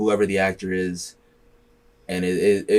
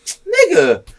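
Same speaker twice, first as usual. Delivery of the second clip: mock disbelief; 5 dB louder than usual. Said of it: "It's like".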